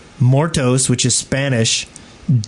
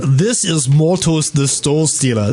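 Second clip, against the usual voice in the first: put-on slow, botched Russian accent drifting Austrian; thick, slow mock Austrian accent; Austrian-sounding Russian accent; slower than usual. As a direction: try Russian, land on Austrian. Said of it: "That is kind of Austrian".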